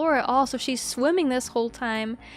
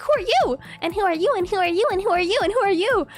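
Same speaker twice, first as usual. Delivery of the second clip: put-on higher, repeated kid questions; a lightly voiced pointing child; eager little girl; 6 semitones higher, 3 dB louder, much faster than usual. Imitating the girl quizzing everyone. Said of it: "She was pointing at everyone like".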